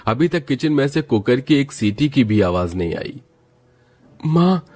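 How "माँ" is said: put-on high whiny voice of a hungry young son; young son whining to his mother about dinner; hungry sleepy kid. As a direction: complain to mom that you're starving and sleepy. Family member asks mother about dinner.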